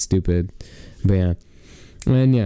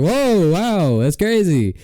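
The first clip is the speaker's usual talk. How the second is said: mock amazement; 7 semitones higher, slower than usual. Mock surprise at wrong answer.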